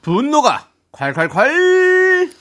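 dramatic title call. Acted.

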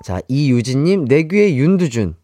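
ordinary talk

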